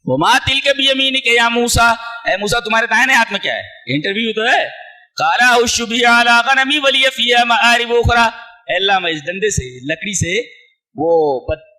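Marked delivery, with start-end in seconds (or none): 0.1-1.9 raised, louder chanted Arabic verse; Quran recitation. Acted. Preacher reciting the verse to the congregation, chanted. 5.2-8.3 raised, louder chanted Arabic verse; Quran recitation. Acted. Preacher reciting the verse to the congregation, chanted.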